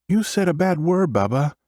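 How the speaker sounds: put-on very high small-girl voice; high-pitched little sister. A narrator's reading. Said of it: "The high-pitched sound of my sister's voice".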